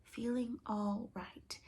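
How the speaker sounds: slow, soft words while writing; slow dictation. A narrator's reading. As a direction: repeat slowly as if noting down. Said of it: "scribbling away".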